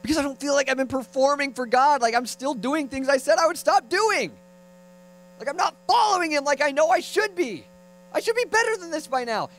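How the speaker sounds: high, strained-up complaint to oneself; mock anguished confession; worked-up self-reproach. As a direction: berate yourself in a rising high voice. Acts out his self-critical answer.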